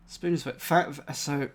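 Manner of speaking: normal talking voice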